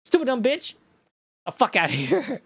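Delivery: high, mocking put-down; inner gloating. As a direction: gloat at the customer in your head. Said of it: "in my head".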